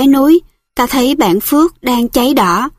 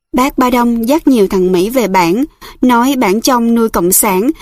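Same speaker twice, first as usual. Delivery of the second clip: plain reading voice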